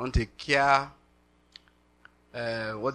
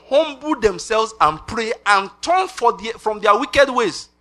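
higher, louder and slow, preaching each word; slow, rising pulpit proclamation of the verse; proclaiming scripture; 7 semitones higher, 6 dB louder, slower than usual. Acted.

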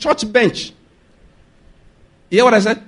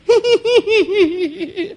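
high-pitched burst of laughter; real amused laugh; 11 semitones higher than usual. Real, not acted. Preacher laughs again.

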